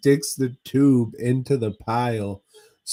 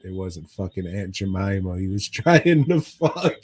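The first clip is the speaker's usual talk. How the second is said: normal talking voice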